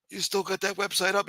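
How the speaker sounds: high, dismissive put-on councilman voice; A-Light voice. Councilman brushing off the harasser, high put-on brushoff. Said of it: "My A-Light voice changed".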